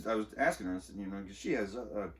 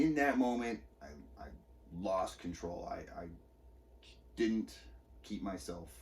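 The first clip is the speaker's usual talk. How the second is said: ordinary delivery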